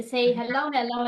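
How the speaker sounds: normal talking voice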